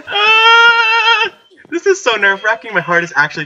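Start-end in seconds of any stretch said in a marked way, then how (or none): 0.1-1.4 long, loud, high shriek; terrified scream. Real, not acted. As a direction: let out a drawn-out scream. Scared again.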